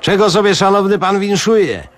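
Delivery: a high, servile barman voice; fawning waiter. Acted. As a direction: play an obsequious little waiter, pitched high. Waiter greets Mok.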